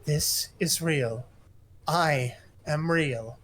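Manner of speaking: slow, deliberate assurance; slow firm assurance; slow insistence. Acted. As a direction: lay each word down slowly. Character insists slowly.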